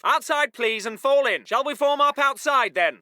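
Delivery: low, courteous officer; polite calm order. Acted. Officer asking chaps to form up outside, politely calm. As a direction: invite them out without any urgency.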